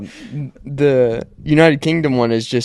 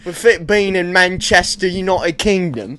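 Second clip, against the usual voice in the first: put-on British accent; 6 semitones higher than usual. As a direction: lay on a thick British accent. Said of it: "you got a good s-, accent on your ass".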